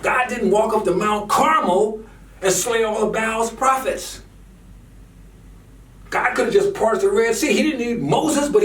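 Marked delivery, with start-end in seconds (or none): none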